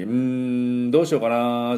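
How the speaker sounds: long humming "hmm" of indecision; mock-puzzled hum into stretched musing; pondering aloud. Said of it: "悩むとき".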